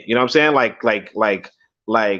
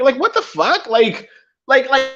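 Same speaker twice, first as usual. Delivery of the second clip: incredulous; 13 semitones higher than usual. Real, not acted. Outraged reaction to her words.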